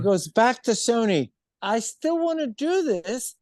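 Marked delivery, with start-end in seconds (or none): 1.6-3.4 much higher, stubborn plea; imitated insistence. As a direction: mimic her refusing to give up. Acts her insisting to Sony.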